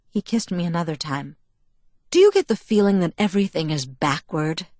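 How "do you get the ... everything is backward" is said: plain reading voice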